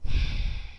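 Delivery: long breathy exhale of distress; heavy sigh. Real, not acted. Upset speaker pausing over a grim history, heavy sigh. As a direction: sigh it out, wordless.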